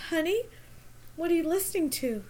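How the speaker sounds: high, soft mock mom asking; lightly voiced sweet mom; imitating a parent. Sister imitating the skit dad gently asking his daughter. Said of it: "walks in and goes".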